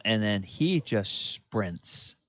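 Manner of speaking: usual speaking voice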